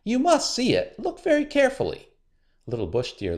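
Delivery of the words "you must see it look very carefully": much higher, fast and pushy; pressing her to look; high impatient trickster; insistent high spider. A narrator's reading. Anansi insisting.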